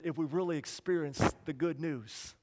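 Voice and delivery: ordinary delivery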